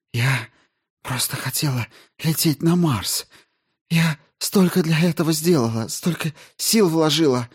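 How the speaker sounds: high, strained outpouring of bitter grief; anguished confession. Acted. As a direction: pour out a long-hidden hatred, voice pitched right up. Delirious Jensen pours out her grievance. (надрывно, с болью и горечью)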